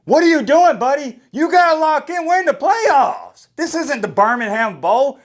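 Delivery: high, loud scolding of a teammate; imitated in-your-face chewing out; in his face. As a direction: get in his face and demand focus. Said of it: "I just got up in his face saying".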